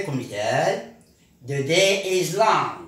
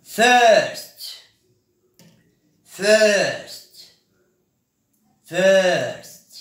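much louder and higher, called out; loud drill call; 6 semitones higher, 7 dB louder, much slower than usual. Shown as a demonstration. Teacher calls out the new word to the class, loud. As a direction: announce the new word to the room.

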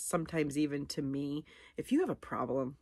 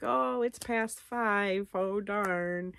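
slow, higher mock dismay at the late hour; 5 semitones higher, much slower than usual. Past five and the calls missed, mock dismay. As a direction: act out the little letdown, drawn out. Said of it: "And then it's like".

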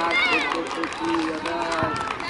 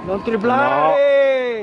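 long, loud, higher-pitched yell; real reproachful shout; 4 semitones higher, 6 dB louder, much slower than usual. Real, not acted. Spectator scolding a player from the sideline, drawn-out shout. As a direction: shout it out, dragging the words.

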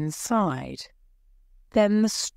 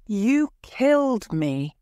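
usual speaking voice

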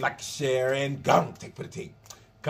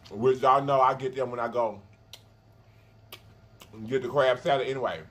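talking between bites; chewing while talking; 4 semitones lower than usual. Real, not acted. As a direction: mouth partly full of food. Seen 0: Bites pepper at -1; pursed, working mouth while talking after.

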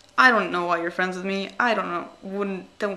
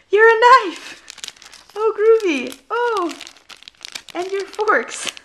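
high, loud, delighted over a tiny box; excited cooing; 11 semitones higher, 7 dB louder, slower than usual. Real, not acted. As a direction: coo at it like a cute surprise. Talking to gift items.

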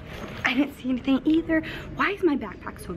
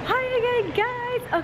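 bright, high-pitched hello; high excited greeting; 10 semitones higher, slower than usual. Real, not acted. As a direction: burst in with a high squeal of excitement.

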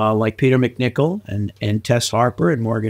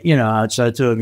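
ordinary talk

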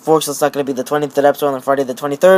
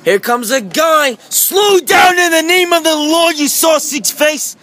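high, slow, shouted mock scolding; mock police shout; 8 semitones higher, slower than usual. Policeman toy yells at passing cars, mock angry. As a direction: bellow like a cross policeman.

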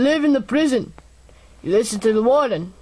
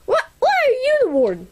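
high squeaky voice; 11 semitones higher, faster than usual. Acted. Squeaky character asks.

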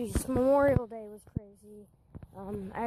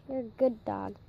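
usual speaking voice

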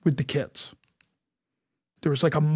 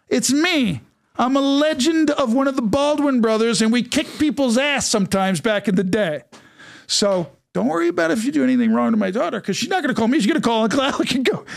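high-pitched, worked-up mock boast; animated, raised-pitch retold warning; joking threat; 6 semitones higher than usual. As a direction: ride over the laughter with a high comic threat.

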